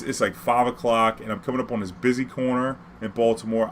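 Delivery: ordinary talk, nothing marked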